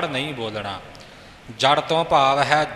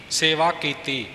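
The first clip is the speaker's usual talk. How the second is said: usual speaking voice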